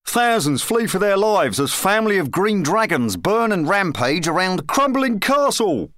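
high-pitched urgent news proclamation; town crier reading news. A narrator's reading. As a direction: cry out the breaking news. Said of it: "coughed, and said".

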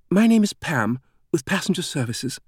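high, gushing British introduction; almost unsettling exuberance. A narrator's reading. Passenger Services woman introducing herself to Langdon, exuberant British voice. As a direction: introduce yourself far too eagerly, in British tones. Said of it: "The woman spoke with an exuberance that was almost unsettling".

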